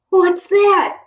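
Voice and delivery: high, loud alarmed cry; frightened cry. Acted. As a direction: cry out at a sudden noise. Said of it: "cried Kenny Ahi".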